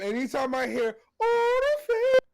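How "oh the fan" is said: high, louder crying wail; mock crying quote. Imitating a sad Gundam scene, a hammed-up sob. Quoting sad anime moment.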